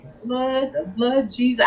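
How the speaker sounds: hushed prayer. Retold or imitated. Praying over her forty-dollar trade, hushed and pleading. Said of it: "I was praying over him".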